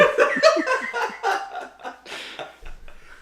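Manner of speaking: airy high-pitched laugh; high breathy laugh. Real, not acted. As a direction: crack up, breathy. Laughing after the joke.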